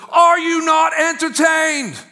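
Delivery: high, drawn-out arena shout; defiant, upset gladiator's bellow; Maximus. Acted. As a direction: roar it slowly as an upset challenge to the crowd. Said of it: "he's all upset, and he goes".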